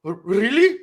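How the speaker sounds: high, rising imitation of an upset woman; mock emotional. Telemarketer moved by the sob story, imitated as emotional. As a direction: squeak it out like a caller getting emotional. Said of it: "she started getting emotional".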